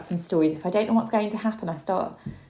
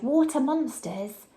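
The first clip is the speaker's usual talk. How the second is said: cried; 6 semitones higher than usual. A narrator's reading. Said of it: "And one cried".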